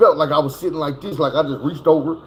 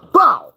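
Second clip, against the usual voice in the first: loud, high mouth-made bow; crash impact noise; 7 semitones higher, 5 dB louder than usual. Retold or imitated. Retelling the crash, a loud imitated bow of impact. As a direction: punch out the sound of hitting a tree. Truck smacking trees in crash.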